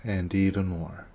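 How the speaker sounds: measured meditation guidance with long silences and falling endings; guided meditation. Real, not acted. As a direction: lead them inward, one short instruction at a time.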